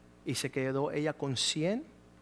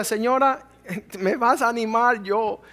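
laughing retort; 6 semitones higher, 8 dB louder than usual. Retold or imitated. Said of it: "Y yo le decía".

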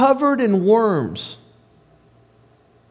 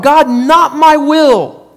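louder and higher, slow earnest cry; slow pleading cry to God; 5 semitones higher, 6 dB louder, slower than usual. Retold or imitated. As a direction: cry out the surrender, drawn out. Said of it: "he cried out".

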